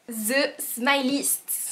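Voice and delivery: announcer voice. Acted. Announcing the brand with applause.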